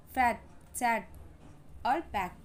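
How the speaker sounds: "फैट, सैड और बैक" with quick short vowels; brisk short A in "फैट, सैड और बैक". Shown as a demonstration. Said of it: "जल्दी से आ का sound".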